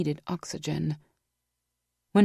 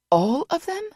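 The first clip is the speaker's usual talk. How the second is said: high, louder rise on "them"; disbelieving cry; 13 semitones higher, 5 dB louder than usual. A narrator's reading. Vanessa asking Boss, high disbelieving rise. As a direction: let "all of them?" jump up high.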